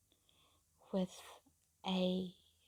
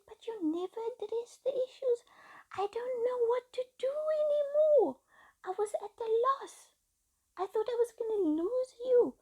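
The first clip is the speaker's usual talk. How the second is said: high, tearful pleading with a cry in the voice; 4 dB louder than usual. Retold or imitated. Wife crying to her husband she fears losing him. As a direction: let the tears break through as she begs.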